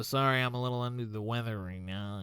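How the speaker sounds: talking through a pinched nose. Shown as a demonstration. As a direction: hold your nose and say it. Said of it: "I just sounded like I was pinching my nose".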